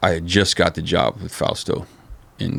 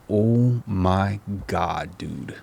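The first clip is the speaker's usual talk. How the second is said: stunned; 5 dB quieter, much slower than usual. Retold or imitated. Stunned watching boss berate Keith.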